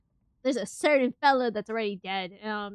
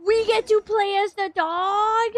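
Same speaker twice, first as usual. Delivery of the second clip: excited squeal; 9 semitones higher, 6 dB louder than usual. Real, not acted. Gasp at playing as the dog.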